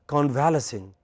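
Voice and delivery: convalescing as con-VAL-uh-sing; mistaken pronunciation. Shown as a demonstration. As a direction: stress VAL in convalescing, short LES. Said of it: "It is not convalescing".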